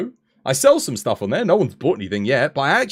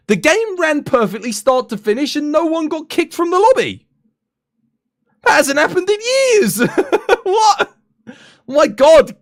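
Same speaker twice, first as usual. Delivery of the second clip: high, loud, laughing disbelief; ecstatic; 5 dB louder, slower than usual. Real, not acted. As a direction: celebrate like a miracle.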